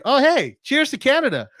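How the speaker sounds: high, loud happy shout-out; excited greeting. Real, not acted. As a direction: burst out cheering a viewer. Greets Canadian viewer in chat.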